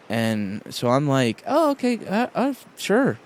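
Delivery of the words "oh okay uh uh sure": high-pitched, unconvinced going along; hesitant agreement. Retold or imitated. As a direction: act out agreeing while clearly unsure. Retells his hesitant answer.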